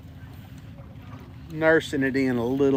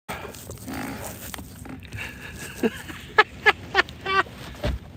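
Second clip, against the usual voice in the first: high breathy laugh; 5 dB quieter than usual. Real, not acted. Laughs after near phone drop.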